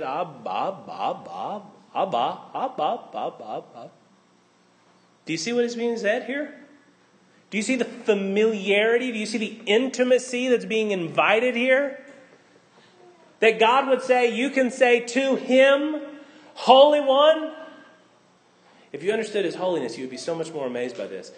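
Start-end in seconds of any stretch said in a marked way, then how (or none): 0.0-3.9 soft, lower babbled Ab, Abba; a hint of a baby repeating its word for dad; lightly voiced infant babble of Abba; baby babbling Ab. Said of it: "an Aramaic baby didn't say Ab". 16.5-17.5 higher, louder Holy One; ringing Holy One; exalted call. Acted. God quoted with awe.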